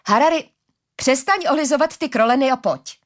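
high, loud scolding order; commanding. A narrator's reading. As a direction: call out sharply and order your companion along. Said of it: "přikázala mu Arila".

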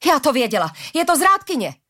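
high-pitched accusing cry; shouted accusation. A narrator's reading. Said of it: "křikla Jarana".

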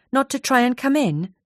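usual speaking voice

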